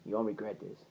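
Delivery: quiet, low assurance; hushed promise. Retold or imitated. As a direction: let the promise drop low and soft. Same promise repeated lower, softer.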